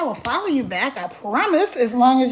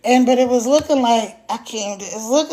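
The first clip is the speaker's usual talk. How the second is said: ordinary delivery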